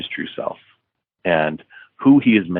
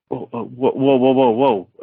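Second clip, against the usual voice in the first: startled; 5 semitones higher than usual. Retold or imitated. Held at gunpoint, startled.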